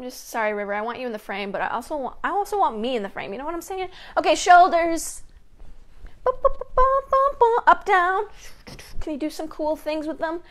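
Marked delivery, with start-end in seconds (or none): 6.2-7.6 high, bouncy "bum" beats; playful high-pitched bum-bums; sung-out bum-bum noises. Real, not acted. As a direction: bop out little sound effects up high. Vocal sound effects for shoulder moves.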